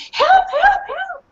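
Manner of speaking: very high yelping dog voice; high dog barking. Acted. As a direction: yelp it like a panicked pup. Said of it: "I barked again and again".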